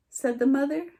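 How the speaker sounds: plain reading voice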